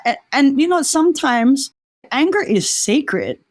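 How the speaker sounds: normal talking voice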